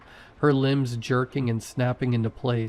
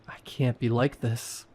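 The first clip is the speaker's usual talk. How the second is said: ordinary delivery, nothing marked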